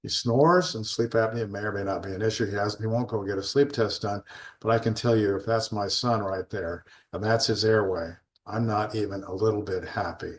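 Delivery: ordinary delivery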